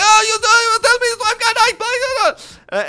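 very high, loud mock rant; hammed-up shrill tirade; ranting customer. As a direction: imitate a customer screeching on and on. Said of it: "she's just going on for ages".

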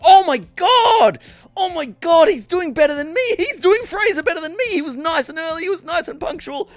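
high excited mock panic; panicked excitement. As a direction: gush in a jealous panic. Panicked inner voice retold.

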